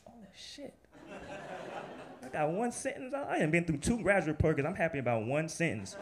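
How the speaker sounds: high, excited inner voice; overjoyed at a small win; giddy relief. Retold or imitated. Inner thought after Go on.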